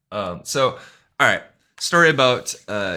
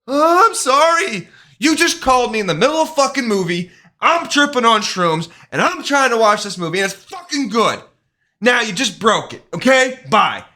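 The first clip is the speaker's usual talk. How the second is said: high, loud furious shouting at the caller; angry yelling; 12 semitones higher, 7 dB louder than usual. Retold or imitated. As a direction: bite the caller's head off, hammed up. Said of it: "I'm all fucking pissed off".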